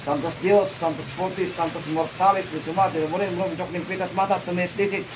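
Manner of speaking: low, steady ritual chant of holy names; low murmured invocation; incantation prayer. Acted. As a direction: intone it like a healer casting out a spell. Recites exorcism incantation.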